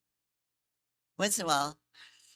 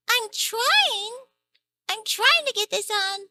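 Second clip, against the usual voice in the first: very high, tiny, slow sweet voice; cute secretary voice; 9 dB louder, slower than usual. Acted. Announcing a call to Mister Satan in a tiny high voice. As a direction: squeak it out like a tiny eager helper. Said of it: "Mister Satan's secretary".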